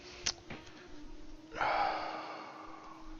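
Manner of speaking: long breathy sigh. Real, not acted. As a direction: let out a slow sigh. Pauses to weigh the battle.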